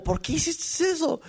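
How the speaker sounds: high, slow, whiny imitation of her; mocking the angry girlfriend. Retelling how the upset girlfriend asked, mocked high and slow. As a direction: mimic her complaint, drawn out and high. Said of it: "se enojó".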